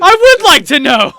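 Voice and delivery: high, loud exasperated shout; indignant yell. Acted. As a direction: yell it back, fed up.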